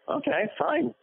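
high, exaggerated sigh of giving in; hammed-up reluctant agreement; mock resignation to the studio; resigned okay. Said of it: "I said".

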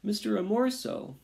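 small mouse shouting; shouted plea. A narrator's reading. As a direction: call out high, pleading. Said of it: "he shouted".

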